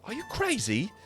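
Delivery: high, loud, incredulous hiss; hissed rebuke. A narrator's reading. Snell scolding the warrior who broke things, hissing. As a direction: shoot it up high in disbelief. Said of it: "Snell hisses at you".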